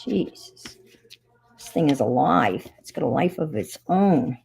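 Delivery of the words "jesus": muttered exasperation. Real, not acted. Frustrated with tape.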